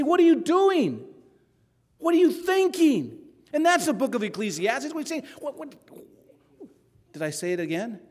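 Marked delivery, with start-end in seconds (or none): 0.0-3.2 higher, exasperated scolding as God; God's rebuke. Acted. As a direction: ask it like a disappointed father. God's rebuke voiced by preacher.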